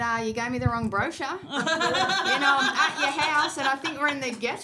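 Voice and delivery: laughing through the words; half-laughing complaint. Retold or imitated. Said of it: "called her up and I said".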